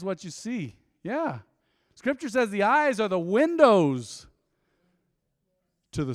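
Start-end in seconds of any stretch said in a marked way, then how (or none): 1.9-4.3 slow and loud, words drawn out; slow proclamation. Real, not acted. Preacher proclaiming scripture to the church, slow and loud.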